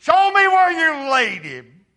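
loud, high cry of command; Jesus demanding to see the tomb, shouting. Retold or imitated. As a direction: call it out with full force. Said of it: "Jesus said these words".

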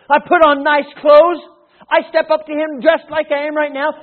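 loud, high, hammed-up outrage; acted offended tirade; indignant. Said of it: "I could be very indignant and say".

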